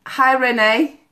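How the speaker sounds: ordinary delivery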